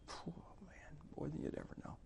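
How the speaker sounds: whispered, awed reply; hushed whisper. Retold or imitated. Friend answering about a father's letter, whispered. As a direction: let it slip out barely voiced.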